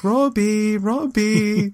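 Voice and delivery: high, drawn-out mock call; imitated calling out. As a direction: sing out the name as if searching the house. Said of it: "he was yelling for Robbie".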